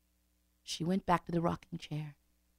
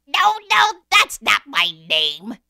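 little man voice; 3 semitones higher, 14 dB louder, slower than usual. Acted. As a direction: sing it out like a gleeful imp. Little man refuses again.

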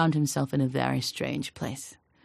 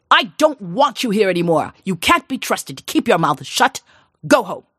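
louder, curt banishing orders; angry dismissal; 3 semitones higher, 5 dB louder than usual. Acted. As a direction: order her out in a temper. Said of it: "he slammed out".

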